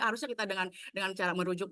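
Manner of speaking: ordinary talk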